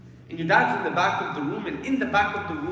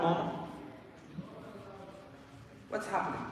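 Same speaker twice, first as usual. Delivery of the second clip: a hint of a dazed child, softer; confused child; 12 dB quieter than usual. Dying child asking his mother, softly and confused. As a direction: ask it quietly, lost and confused. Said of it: "you're like".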